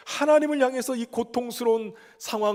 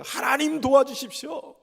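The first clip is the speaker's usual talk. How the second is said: high, urgent plea shouted up to God; acted desperate prayer, voice raised high; crying out in prayer; 6 semitones higher, 3 dB louder than usual. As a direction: cry out loudly for rescue, pitched well up. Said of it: "울부짖으면서 크게 외쳤다는 거예요".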